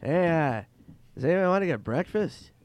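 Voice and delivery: high, loud drawn-in impression; Norm Macdonald. Norm texting the group at dawn, high loud impression. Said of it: "Norm goes on the mass text, he goes".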